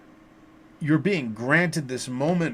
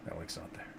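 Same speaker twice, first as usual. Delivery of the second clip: breathy whisper under the breath; a resigned whispered mutter to herself; whispered aside; 8 semitones lower, 19 dB quieter than usual. Real, not acted. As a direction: repeat it to yourself in a whisper, almost silent. Muttered repeat after laughing.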